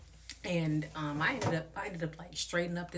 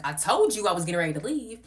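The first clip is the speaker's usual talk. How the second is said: loud, exasperated reply; loud exasperated reply to the host; loud retort; 4 semitones higher, 8 dB louder than usual. Retold or imitated. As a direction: tell her off loudly. Own retort in argument.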